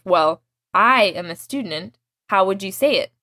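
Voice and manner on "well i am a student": "I" leaned on and lengthened; stress on I. Shown as a demonstration. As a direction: draw out I in contrast to the other person. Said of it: "back along the lines of".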